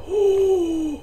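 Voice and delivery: sharp high gasp of discovery; sudden gasp. Real, not acted. Gamer gasping at his new trading method. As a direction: gasp like you just struck gold.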